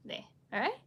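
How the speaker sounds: ordinary delivery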